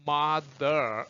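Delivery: "mother" with the tongue pulled back on the final r; r-colored ending. Shown as a demonstration. Teacher showing students the R sound, r-colored "mother". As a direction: draw out "mother", ending on a held, uncurled "er". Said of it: "혀를 뒤로 당기세요".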